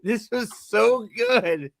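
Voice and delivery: slow, high, hammed-up delight; mock rave. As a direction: gush slowly like a thrilled fan. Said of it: "everybody's like".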